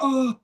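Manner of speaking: high squeaky oof; mimicked grunt. Player retelling a girl's tackled gasp, high and squeaky. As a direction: imitate the air knocked out of her. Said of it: "She's like".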